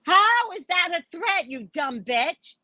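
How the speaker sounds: high-pitched, drawn-out exasperation; indignant comeback. Retold or imitated. Reader retorting to a client who took it as a threat, indignant.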